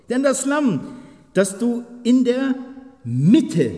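high, loud ringing start; joyful favorite verse; excited proclamation. Real, not acted.

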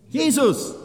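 high, loud "Jesus"; exultant answer to the question; triumphant answer. Real, not acted. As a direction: ring out the answer.

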